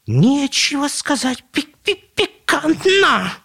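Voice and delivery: very high, slow squeak with a stutter; drawn-out shrieky chick voice; stammering chick. Acted. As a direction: peep the stuck syllables like a startled chick.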